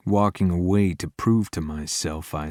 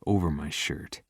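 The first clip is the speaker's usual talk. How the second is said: plain reading voice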